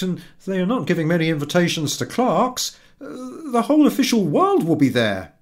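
high, loud, pleading husband voice with stammers; eager husband. A narrator's reading. Husband trying to win over his wife, high and eager. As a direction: coax her, flustered and hopeful. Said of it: "husband pleading eagerly".

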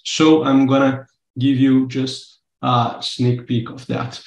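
usual speaking voice

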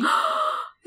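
sharp high gasp of real surprise; surprised gasp. Real, not acted. Co-host hearing the dog's name, a real surprised gasp. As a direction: gasp as if caught off guard.

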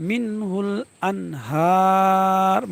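আনহার with a long-held হা, closing on a vowelless r; slow pausal আনহার without the final u; three-alif waqf. Shown as a demonstration. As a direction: stretch হা three beats in মিনহুল আনহার. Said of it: "এক আলিফের জায়গায় তিন আলিফ টান".

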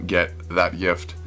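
ordinary talk

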